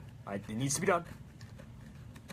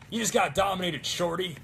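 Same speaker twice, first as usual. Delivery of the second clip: higher, louder gloating taunt; engineer taunt; 6 semitones higher, 5 dB louder than usual. Retold or imitated. Cosplayer quoting the engineer dominating, gloating. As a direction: crow it like a game taunt. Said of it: "Engineer, just when he's like".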